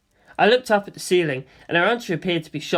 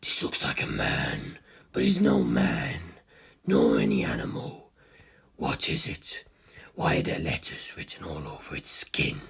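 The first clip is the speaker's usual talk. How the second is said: cold ancient being; 7 dB quieter than usual. A narrator's reading. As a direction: breathe the question in calm interest. Cars voice.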